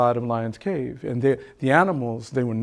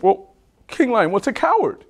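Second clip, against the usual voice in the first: puzzled monkey; 9 semitones higher, 3 dB louder than usual. Acted.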